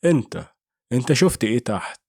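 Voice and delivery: usual speaking voice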